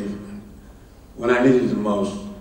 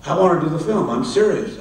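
higher, louder earnest assurance; retold insistence; 7 semitones higher, 5 dB louder than usual. Studio man assures doubting writer about the film, insistent. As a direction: press the point as if to a doubter.

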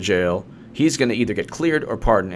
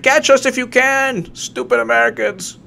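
sing-song jeer at the Americans; mock taunting Russian; 13 semitones higher, 4 dB louder than usual. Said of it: "He's like".

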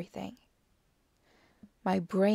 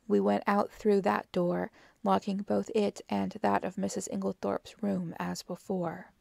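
ordinary delivery, nothing marked

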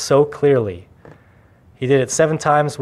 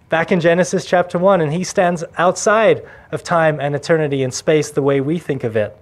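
usual speaking voice

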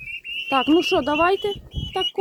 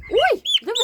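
laughing; 9 semitones higher, 4 dB louder than usual. Real, not acted. Laughing at the poult.

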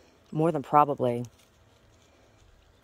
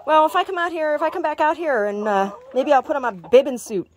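high, louder chatter to the hens; pet talk; 8 semitones higher, 6 dB louder than usual. Real, not acted. Woman telling her chickens about garden plans, high pet talk. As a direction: keep the bright high pet voice going.

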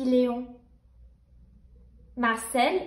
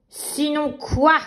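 slow sneering villain snicker; sneering panto villain; slower than usual. Acted. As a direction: taunt it slowly like a panto baddie. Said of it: "ricane le méchant rêve".

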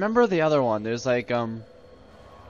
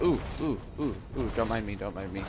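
furtive squeezing past; 5 semitones lower, 11 dB quieter, faster than usual. Acted. Acts slipping past soldiers.

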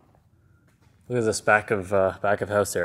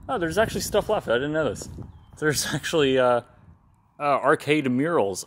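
surprised; 6 semitones higher than usual. Real, not acted. Finds leftover arcade murals.

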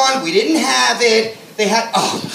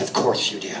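deadpan sarcasm; 10 dB quieter than usual. Retold or imitated. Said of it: "I said".

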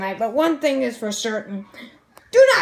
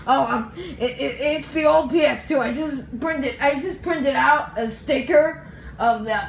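ordinary delivery

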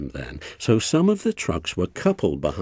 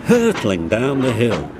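ordinary delivery, nothing marked

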